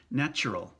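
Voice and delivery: natural with a ch sound, nachural; English natural. Shown as a demonstration. Teacher showing the English chew in natural. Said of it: "the chew sound in there".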